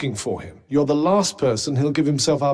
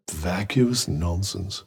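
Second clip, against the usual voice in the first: dismissive; 8 semitones lower, slower than usual. Acted. Dismisses something as nonsense.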